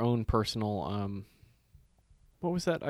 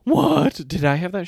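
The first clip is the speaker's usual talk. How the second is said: loud, high shocked yelp; shocked disbelief; 7 semitones higher, 10 dB louder than usual. Retold or imitated. Grandson stunned by grandma's news, high loud disbelief. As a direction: blurt it out, stunned.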